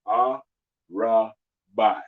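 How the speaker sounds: Arabai, very slow, syllable by syllable, a-ra-bai; broken into syllables. Shown as a demonstration. Teacher slowly breaking the word down for students. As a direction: stretch Arabai out piece by piece. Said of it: "You can break 'em up".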